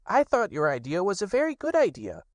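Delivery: high, small piglet voice. Acted. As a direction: squeak it out like a tiny worried piglet. Piglet comforts Pooh.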